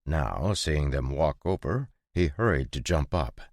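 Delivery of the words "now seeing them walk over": ordinary delivery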